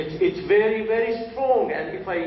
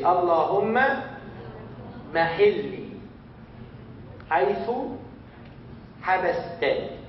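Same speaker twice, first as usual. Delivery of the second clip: word by word with long pauses; slow dictation pace; slow and clear. Shown as a demonstration. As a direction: spell the phrase out slowly for learners. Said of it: "I will say it slowly".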